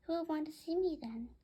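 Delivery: usual speaking voice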